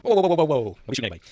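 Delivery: loud, high string of whoas easing off; mock alarm. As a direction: imitate someone frantically calming a standoff. Echoing the bar standoff clip.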